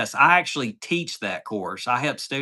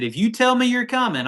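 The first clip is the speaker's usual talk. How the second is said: much higher, animated quote of the owner; emphatic retold offer; 11 semitones higher, 4 dB louder than usual. Store owner offers to wait for a late customer, emphatic. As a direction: lift the pitch to press the offer home.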